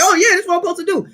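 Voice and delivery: high, quick breezy yes; carefree agreement. Retold or imitated. Someone easily going along with worldly life, carefree and quick. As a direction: toss it off with no doubt at all. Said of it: "It was just like".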